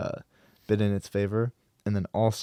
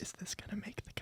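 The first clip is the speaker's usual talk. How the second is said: hushed teasing whisper; giggly prank whisper; 11 semitones higher, 20 dB quieter, faster than usual. Acted. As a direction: whisper it like sharing a secret. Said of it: "whisper into the mic".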